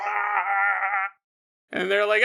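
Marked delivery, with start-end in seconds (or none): 0.0-1.1 short mock cry of pain. Daughter with bleeding mouth, short mock cry. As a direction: yelp like a cut mouth. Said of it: "She's like".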